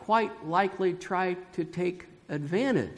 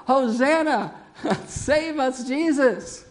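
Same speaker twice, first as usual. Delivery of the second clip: high, raised mock acclaim; crowd crying out; 7 semitones higher, 4 dB louder, slower than usual. Crowd hailing Jesus as saviour, high excited cry. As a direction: cheer and plead like an adoring crowd. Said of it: "cried out".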